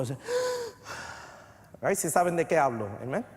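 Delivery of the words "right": demonstrating the sigh while weeping; deep sigh. Shown as a demonstration. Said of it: "haces ese, ese suspiro profundo".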